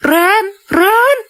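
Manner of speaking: crying out his name in distress; a sob caught in the call. Acted. Mother calling Ren through tears after grandma dies.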